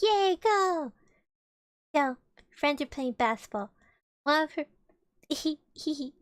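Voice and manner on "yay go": high mock cheer; playful imitated cheer. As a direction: cheer from the bench. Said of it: "She's like".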